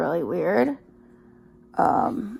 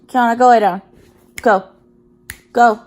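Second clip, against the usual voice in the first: louder firm dog command; 3 semitones higher, 9 dB louder than usual. Real, not acted. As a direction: send the dog to bed, firmly. Ordering dog to bed.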